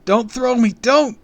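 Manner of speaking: very high, loud begging; terrified plea. A narrator's reading. Kid held overhead by villain, terrified wail. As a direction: cry out in panic. Kid begs not to be thrown.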